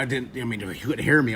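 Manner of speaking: usual speaking voice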